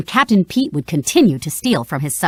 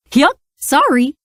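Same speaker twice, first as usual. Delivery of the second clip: higher, goofy apology; 6 semitones higher than usual. A narrator's reading. Goofy, hooded, apologises after tripping, goofy. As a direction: bumble out an apology.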